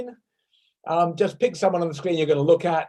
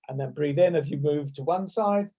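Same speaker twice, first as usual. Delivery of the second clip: ordinary talk, nothing marked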